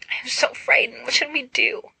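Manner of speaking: high whispered fearful plea; cling and plead in a hush; breathy fright. Acted. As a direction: Carmina pleading with Narcissa while pressed against her.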